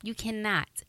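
ordinary talk

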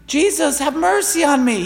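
a blind man's high, pleading cry. Retold or imitated. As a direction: call out high to Jesus, begging. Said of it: "He starts crying out".

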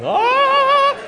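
high, loud show-off yell; boastful shout. Retold or imitated. Dad boasting to his son about his shout, loud high yell. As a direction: show off your amazing shouting voice. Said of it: "my shouting voice that was amazing".

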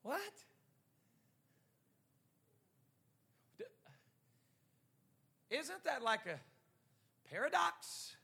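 high, incredulous questioning; acted puzzlement, voice leaping up; mock bewilderment. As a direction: pitch it up sharply as if baffled. Acts out puzzlement at the verse.

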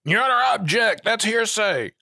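high, loud lawyer's objection; confident lawyer objecting. Acted. Mock lawyer objecting in court.

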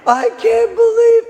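high, slow, tearful shout of wonder; overwhelmed hippie weeping at a rainbow; crying hippie. Retold or imitated. Hippie camper sees a double rainbow, high tearful shout. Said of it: "and he's crying".

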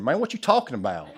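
put-on joking Southern-accented comeback. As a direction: toss it off fast in a Southern accent. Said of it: "I didn't expect to hear that Southern accent".

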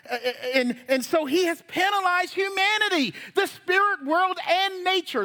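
high, driving sermon delivery; forceful preaching. Real, not acted. Preacher warning the congregation about judgment of sin, forceful preaching. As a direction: press each phrase home with rising urgency.

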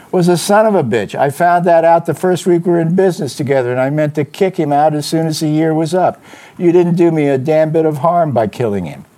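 rough it up and push it out; hoarse voice. A narrator's reading. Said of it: "Spade said hoarsely".

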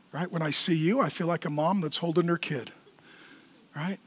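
low, very soft and heartfelt; hushed, sincere thanks with long pauses; tender pastoral address. Real, not acted. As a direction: speak gently to people you love.

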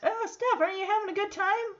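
dad coaxing his sad daughter sweetly. Retold or imitated. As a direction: coax a sulking kid gently. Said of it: "and asks".

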